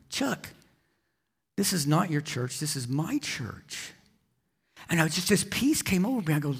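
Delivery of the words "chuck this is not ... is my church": soft, slow, a hint of a gentle divine voice; lightly voiced Lord, softer and unhurried. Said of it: "the Lord told him".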